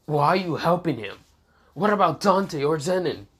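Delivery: alarmed questions. Acted. Lucas alarmed by sister's betrayal.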